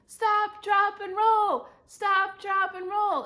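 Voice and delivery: loud, high shouted chant; crowd shout. A narrator's reading. Everybody to the burning girl, shouting. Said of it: "everybody shouted".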